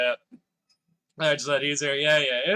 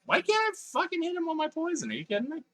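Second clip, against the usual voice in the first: frustrated; faster than usual. Real, not acted. Frustrated at game.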